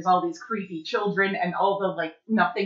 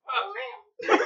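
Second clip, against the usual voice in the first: hammed-up goofy killer, pitched way up; Michael Myers. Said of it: "here's my Michael Myers impression".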